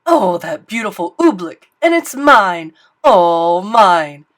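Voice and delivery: loud, greedy royal joy; king gloating loudly over oobleck; gleeful king. Acted. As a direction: crow over it like a treasure.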